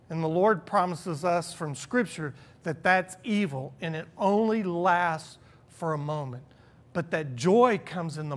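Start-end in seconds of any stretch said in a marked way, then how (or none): none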